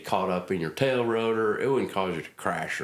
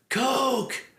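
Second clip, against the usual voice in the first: cheery colonel; slower than usual. Retold or imitated. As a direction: boom a happy hello down the line. Old colonel greets cheerfully by phone.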